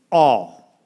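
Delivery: all punched out loud and long; stress on all. Shown as a demonstration. Pastor stressing all to the congregation, loud emphasis. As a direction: lean hard on the word all, held apart. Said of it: "Emphasis on the word all".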